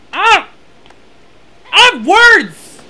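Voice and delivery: high, loud sputtered frustration; sputter, then burst out loudly; exasperated outburst. Real, not acted. Player lost for words at friend, exasperated outburst.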